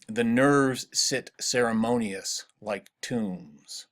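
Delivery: slow iambic reading of "The nerves sit ceremonious like tombs"; alternating stresses. Shown as a demonstration. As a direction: draw out "nerves" and "tombs", measured, falling at the end. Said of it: "you can also hear".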